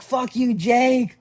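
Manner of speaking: high, loud jokey curse at a friend; playful yelling. Retold or imitated. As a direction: holler it across the street in fun. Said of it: "I was yelling".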